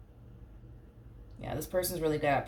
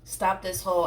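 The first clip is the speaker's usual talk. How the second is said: usual speaking voice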